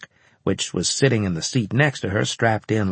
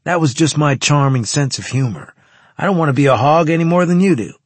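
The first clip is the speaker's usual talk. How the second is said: higher, hammed-up indignant protest; mock outrage; 6 semitones higher, 5 dB louder than usual. A narrator's reading. Carlos defends his joke to his squadmate, in mock outrage. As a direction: protest as if offended, rising louder and higher. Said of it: "He looked at me in mock outrage".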